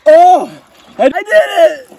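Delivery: high, loud victory cry; triumphant shout. Real, not acted. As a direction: yell it out in triumph. Solves cube in ice bath.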